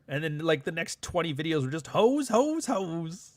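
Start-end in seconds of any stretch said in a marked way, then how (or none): 1.9-3.3 high, drawn-out repeated mimicry; mocking rant. Commentator parodying the creator's next videos, mock ranting. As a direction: ride the repeated word up high in mockery.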